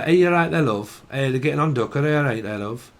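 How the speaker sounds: imitated Yorkshire chit-chat; Yorkshire lilt, falling on the last word; Yorkshire accent. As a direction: greet a neighbour in broad Yorkshire. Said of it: "I used to talk like that myself".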